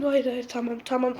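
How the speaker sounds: usual speaking voice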